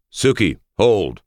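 high, loud, drawn-out order to halt; urgent command. A narrator's reading. Master calls off his charging guard, commanding shout. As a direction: call the guard off sharply.

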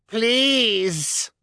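high, loud yelp of a fall; shouting. A narrator's reading. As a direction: shout it while crashing down. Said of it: "Gunther shouted again".

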